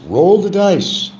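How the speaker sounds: high, loud, stretched-out call; mock urging. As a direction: sing it out like a dare.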